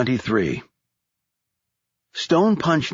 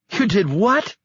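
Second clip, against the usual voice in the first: Annabelle screaming; 11 semitones higher than usual. A narrator's reading. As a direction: shriek it in disbelief. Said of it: "she screamed into the phone".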